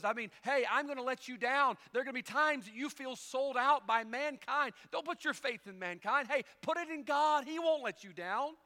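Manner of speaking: loud, high, driving sermon delivery; preaching at full force. Acted. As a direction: preach it out, building energy.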